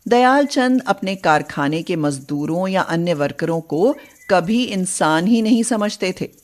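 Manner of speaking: ordinary delivery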